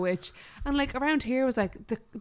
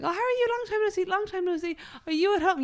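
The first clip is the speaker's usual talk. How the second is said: much higher, a chirpy polite meeting voice; mock small talk; 11 semitones higher, faster than usual. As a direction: gush like an awkward work call. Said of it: "And I was just like".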